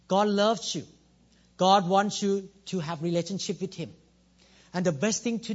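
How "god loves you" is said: ordinary delivery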